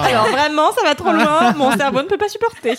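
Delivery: laughing hard while talking; words breaking into laughter. Real, not acted. Laughing at the joke.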